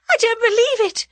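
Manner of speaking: high, loud pleading wail; frantic high-pitched protest; terrified moan. A narrator's reading. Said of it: "she moaned".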